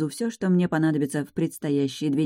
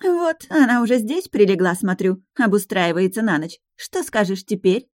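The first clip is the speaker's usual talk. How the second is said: higher, louder sneering reproach; reproachful; 5 semitones higher, 4 dB louder than usual. Acted. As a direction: sneer it at him. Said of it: "укоризненный голос Алианны".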